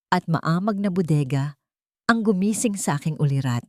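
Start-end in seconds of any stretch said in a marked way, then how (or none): none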